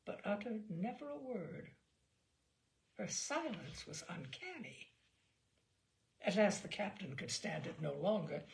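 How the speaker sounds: usual speaking voice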